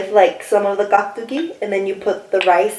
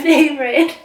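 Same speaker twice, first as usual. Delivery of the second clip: laughing; 6 semitones higher than usual. Real, not acted. Laughs while talking about favorite food.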